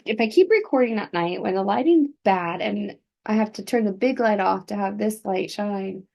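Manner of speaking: plain reading voice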